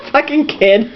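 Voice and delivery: ordinary delivery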